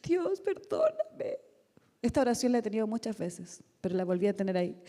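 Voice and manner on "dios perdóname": high, slow plea remembered through tears; retold weeping prayer, much higher and slow; tearful plea. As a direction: beg forgiveness slowly, voice pitched up. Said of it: "Lloraba arrepintiéndome, decía".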